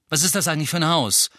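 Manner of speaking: quick, loud snapped question; snapping. A narrator's reading. Harry snaps at Ron and Hermione, fast and loud. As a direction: bark the question at them. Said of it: "blaffte er".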